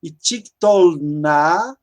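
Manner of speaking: tiktolna spelled out very slowly, syllable by syllable; slow Hebrew demo. Shown as a demonstration. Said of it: "é o feminino".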